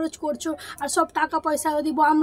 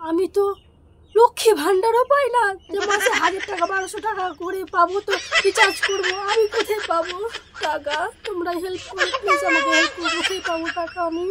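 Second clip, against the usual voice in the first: higher, hammed-up wail of despair; hammed-up pleading; 4 semitones higher, 4 dB louder than usual. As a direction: beg for help in mock desperation. Exasperated mock plea for money. (নাটকীয় কাতর অনুনয়)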